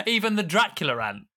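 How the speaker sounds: usual speaking voice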